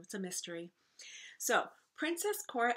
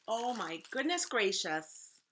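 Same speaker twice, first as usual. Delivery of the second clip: usual speaking voice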